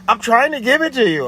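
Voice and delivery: imitating the caller insisting she is trying; defensive caller. Her defensive retort.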